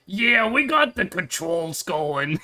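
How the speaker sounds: slow, drawn-out put-on Jersey tough; mismatched character voice. Streamer mocking their own casting, slow hammed-up character voice. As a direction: overdo the wrong voice for a tiny character. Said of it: "then I am here going like".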